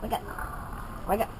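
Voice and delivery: breathy little whisper, barely voiced. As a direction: whisper it like a secret wake-up call. Child playing asleep, grandma reading.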